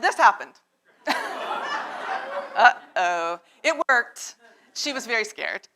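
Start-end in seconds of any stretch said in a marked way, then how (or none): 1.0-2.5 burst of laughter. Real, not acted. Laughing after the big shout.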